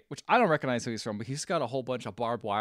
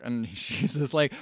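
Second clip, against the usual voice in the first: ordinary delivery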